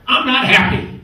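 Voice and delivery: grumpy dwarf. Retold or imitated. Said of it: "He turns and looks at me and goes".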